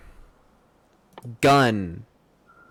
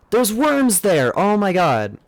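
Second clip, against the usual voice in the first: higher, stretched-out cry of dismay; exasperated dismay; 6 semitones higher than usual. Real, not acted. Gamer finding worms blocking the way, exasperated dismay. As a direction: start high and slide down in dismay.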